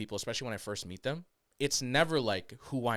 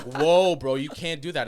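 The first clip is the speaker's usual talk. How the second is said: loud, higher mock objection; imitated outcry, louder and raised; acted protest; 4 semitones higher, 10 dB louder than usual. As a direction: call out a shocked protest as the crowd. Acts out people objecting to accent.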